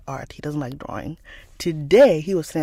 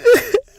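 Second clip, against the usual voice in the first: loud, high mock sob; hammed-up bawling sound; imitated child's sob; 9 dB louder than usual. Mother imitating her crying son, mock sob. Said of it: "He starts crying".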